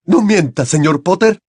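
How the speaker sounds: high, loud, angry reproach; shouted accusation. A narrator's reading. Crouch holding the children at wandpoint, shouting. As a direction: shout him down as a liar. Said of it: "gritó el señor Crouch".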